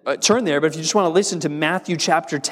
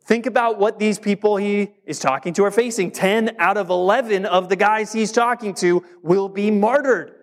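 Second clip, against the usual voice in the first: ordinary talk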